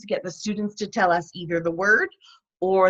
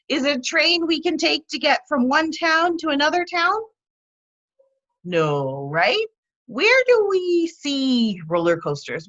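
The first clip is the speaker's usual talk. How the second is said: lively high questioning, praising answers; eliciting demo; 4 semitones higher than usual. Shown as a demonstration. Teacher eliciting meaning from students, lively and encouraging. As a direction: coax the class with questions and quick praise. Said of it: "You could focus on eliciting".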